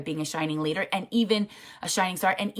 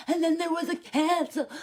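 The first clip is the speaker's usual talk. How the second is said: fighting back tears as you say it; a real sob breaking through; choked up; 10 semitones higher than usual. Real, not acted. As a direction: voice catching high with real tears. Tearing up recalling the cancellation.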